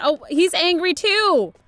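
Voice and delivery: high and loud; exasperated, laughing outburst; startled exasperation. Real, not acted. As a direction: throw the question out in disbelief. Shocked at angry character.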